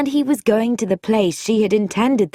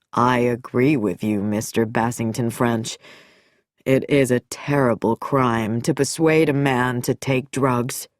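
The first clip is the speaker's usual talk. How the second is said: low, grave doctor; deep and solemn, regretful; doctor's voice; 6 semitones lower than usual. A narrator's reading. Nicholson's grave, lowered speech.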